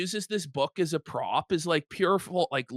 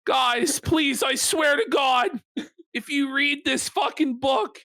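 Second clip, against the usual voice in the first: mock pleading Joe; 7 semitones higher than usual. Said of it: "He's like".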